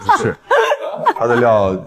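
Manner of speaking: laughing while talking. Real, not acted. Laughing at the pun.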